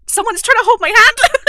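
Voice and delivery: high, loud, giggly quote; mock-squealed excitement; excited reenactment. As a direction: act out giddy excitement, nearly laughing. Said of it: "I was so excited".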